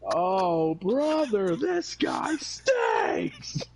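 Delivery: laughing, high-pitched mock heckle; heckler call. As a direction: stretch it high and slow like a whining fan.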